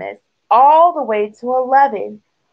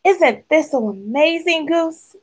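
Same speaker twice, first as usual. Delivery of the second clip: higher, stretched-out wonder; amazed duck; 8 semitones higher than usual. A narrator's reading. Duck marvels to Goose at Thistle, amazed. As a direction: marvel at it in a high, drawn-out voice. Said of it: "Duck quacked".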